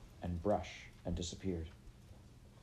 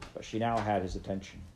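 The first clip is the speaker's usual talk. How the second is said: plain reading voice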